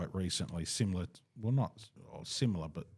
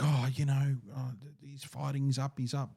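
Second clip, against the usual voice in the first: hopeful excitement; 5 semitones higher than usual. Retold or imitated. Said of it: "it's like".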